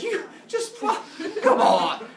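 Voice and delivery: high sputter into a loud protest; mock exasperation. Rhea watching another baby swallowed, loud exasperated protest. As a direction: break off and yell in hammed-up dismay.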